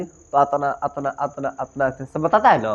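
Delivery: normal talking voice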